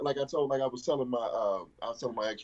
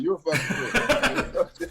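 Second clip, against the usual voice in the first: slow, laughing mock insult, higher; 6 semitones higher, 4 dB louder, much slower than usual. Friend mocking the insult back through laughter, slow. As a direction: drag it out while cracking up. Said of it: "I'm like".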